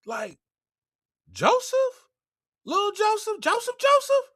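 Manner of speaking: very high, incredulous questions; stunned disbelief. Retold or imitated. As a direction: voice baffled brothers in a comic falsetto. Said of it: "they started looking like".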